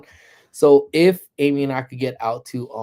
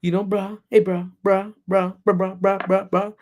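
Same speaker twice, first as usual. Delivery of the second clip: higher, repetitive mock teen chatter; raised-pitch imitation of girls bantering; mimicking the girls; 6 semitones higher, 3 dB louder than usual. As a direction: fire off slang like mocked teenage girls. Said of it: "But they're like".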